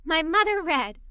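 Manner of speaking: usual speaking voice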